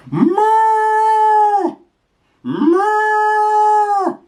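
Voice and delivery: high loud mooing; cow moo. Shown as a demonstration. Cow sound for toddler.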